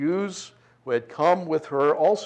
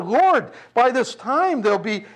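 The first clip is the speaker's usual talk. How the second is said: shocked objection, raised and pitched up; alarmed protest, loud and high; 8 semitones higher, 6 dB louder than usual. Acted. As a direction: stop him before he opens it. Martha objecting to opening tomb.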